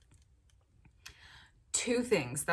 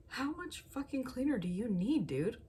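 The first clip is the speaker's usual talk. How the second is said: higher-pitched, exasperated imitation of herself; playfully exasperated, pitched up in disbelief; mock exasperation; 7 semitones higher than usual. Wife to her partner over too much cleaner spray, mock exasperated.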